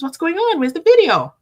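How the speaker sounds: hammed-up demand for the missing video; mock demanding viewers. Viewers pestering the vlogger in her messages, demanding.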